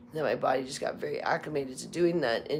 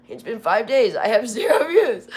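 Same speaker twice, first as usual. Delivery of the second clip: laughing at zero views; 8 semitones higher, 11 dB louder than usual. Retold or imitated. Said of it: "I'm like".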